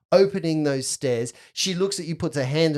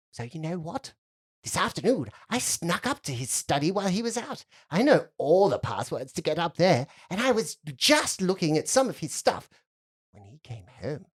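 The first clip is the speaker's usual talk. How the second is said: put-on confiding little girl; 4 semitones higher than usual. Girl confessing she snuck into study, secretive. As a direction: let it sink to a near hush at the end.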